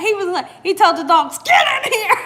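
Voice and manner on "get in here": high loud retold shout, laughing; mock yell. Husband calling the dogs inside, high loud laughing shout. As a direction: bark the order at the dogs, laughing. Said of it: "My husband was so mad".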